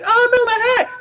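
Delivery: high, loud cartoon cry of dismay; mock dismayed girl. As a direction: imitate a girl losing her hat. Voices girl losing hat to wind.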